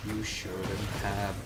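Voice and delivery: slow, drawn-out threat to little Steve; menacing villain taunt. Pretend. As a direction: gloat over the loser, slowly. Taunting beaten opponent in game.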